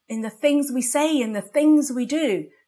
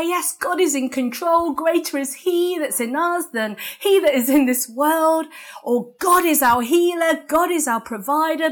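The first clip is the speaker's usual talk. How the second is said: higher, confident mock proclamation; religious declaring; 6 semitones higher, 3 dB louder than usual. Preacher imitates believers reciting faith, higher proclaiming tone. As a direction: declare it like a pat churchy slogan. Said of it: "we can declare".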